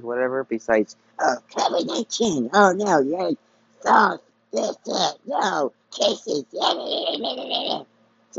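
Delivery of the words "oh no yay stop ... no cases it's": high, squeaky mock alarm; mocking panic voice. Vlogger mocking covid panic, squeaky high mock alarm.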